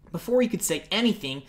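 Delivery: usual speaking voice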